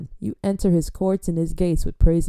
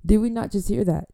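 ordinary delivery

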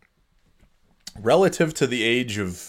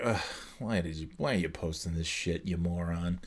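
low, quiet groan at yourself; soft, weary self-reproach; disgusted self-scolding; 5 semitones lower, 11 dB quieter than usual. Retold or imitated. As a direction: mutter it under your breath, appalled. Groaning at own old posts.

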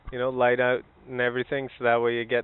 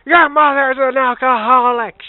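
much higher, much louder slow yell; mock shout; 12 semitones higher, 16 dB louder than usual. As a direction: fling the insult and leave. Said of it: "Just walking".

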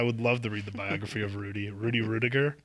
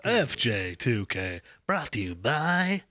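announcer voice; slower than usual. Acted. Show title announcement.

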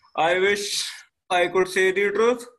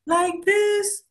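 much higher, thin pitch; 13 semitones higher than usual. Shown as a demonstration. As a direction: squeeze the voice up high and thin. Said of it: "our pitch gets thinner, like this".

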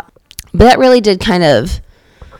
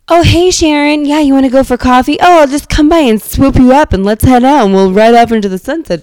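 higher-pitched, mock-carefree invitation; chirpy hammed-up coffee invite; breezy friend; 6 semitones higher, 4 dB louder than usual. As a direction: imitate an effortlessly spontaneous friend. Acted breezy friend inviting Sharon out.

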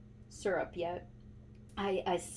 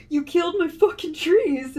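mock angry grandpa; 9 semitones higher, 9 dB louder than usual. Grandpa yells about girdled trees.

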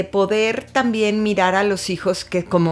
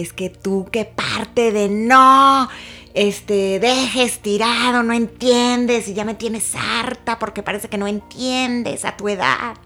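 1 retold scolding; 2 semitones higher, slower than usual. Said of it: "Pero yo llegué gritando, regañándolo".

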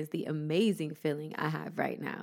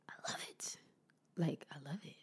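whispering; 3 semitones lower, 14 dB quieter than usual. Real, not acted. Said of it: "repeated affirmation, whispered".

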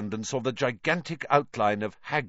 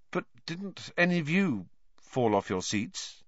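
hesitant, higher-pitched awkward question; 6 semitones higher than usual. A narrator's reading. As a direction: ask it uneasily, pitched up a little. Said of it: "said Harry awkwardly".

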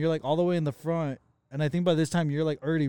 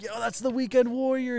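high calling voice, held back; mock yelling; 8 semitones higher than usual. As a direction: imitate calling out to someone in a crowd. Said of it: "we start yelling at".

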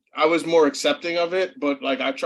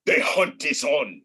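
Kraven the hunter; slower than usual. Retold or imitated. Friend mimics Kraven's big movie line, slow and ominous. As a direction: drawl it out like a movie hunter stalking prey. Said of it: "my man Craven's gonna be like".

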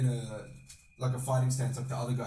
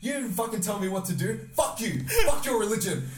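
retold angry rant; 7 semitones higher than usual. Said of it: "And I was like".

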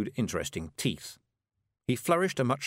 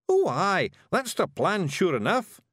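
high, perky Scots agreement; brightened-up Scottish Feegle; 9 semitones higher, 4 dB louder, slower than usual. A narrator's reading. Rob agreeing that charging in is the plan, brightening up. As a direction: perk up loudly in high Scots. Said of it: "brightening up".

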